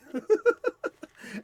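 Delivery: quick burst of high laughter; high cackling titter; high-pitched giggle. Real, not acted. Laughs at own awful evil elf.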